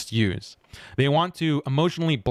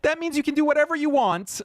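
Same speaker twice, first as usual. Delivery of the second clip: very high mocking falsetto; hammed-up parody of the entitled mom. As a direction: sneer at her by parroting in a whiny high voice. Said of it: "just because you're a mom".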